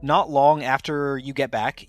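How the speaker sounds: ordinary talk, nothing marked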